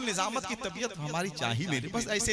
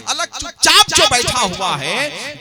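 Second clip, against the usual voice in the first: much louder and slower, stretched जो; loud slow take; 5 semitones higher, 16 dB louder, slower than usual. Acted. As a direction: fling the line out to the crowd. Same line thrown loud. (ऊँची, खिंची हुई आवाज़ में)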